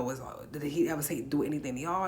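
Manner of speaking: ordinary delivery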